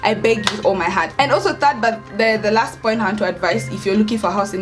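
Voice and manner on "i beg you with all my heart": normal talking voice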